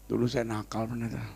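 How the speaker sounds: very soft, low retold admission; hushed and low; sheepish confession. Voices sick man's confession.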